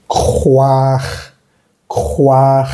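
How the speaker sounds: "juaj" as krwar, throat R at both ends; raspy R alone, then whole "juaj"; throaty French R. Shown as a demonstration. Teacher assembles croire, "juaj" with throaty French Rs. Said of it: "la siguiente R".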